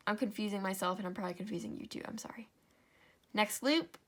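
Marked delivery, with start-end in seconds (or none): 2.0-2.5 soft whispered sorry under the breath; embarrassed whispered "I'm sorry"; whispered apology. Real, not acted. Apologizing aside for confusion.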